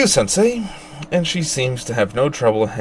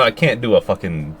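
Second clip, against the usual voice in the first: usual speaking voice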